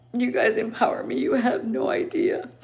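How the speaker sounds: moved and grateful, pitch lifting; tearful gratitude. Real, not acted. Telling viewers they empower her, tearful. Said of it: "I'm crying 'cause I'm grateful".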